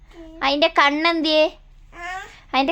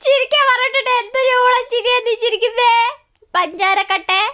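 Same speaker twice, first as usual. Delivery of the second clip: gushing teasing; 9 semitones higher, 4 dB louder than usual. Real, not acted. Mother teasing baby about a silly laugh, gushing.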